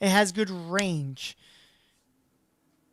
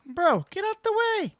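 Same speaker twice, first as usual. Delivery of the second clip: high-pitched, raised-voice protest at a teammate; exasperated call; 12 semitones higher, 6 dB louder than usual. Real, not acted. Gamer telling a teammate to move, exasperated call. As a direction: call out, pitch jumping up in frustration.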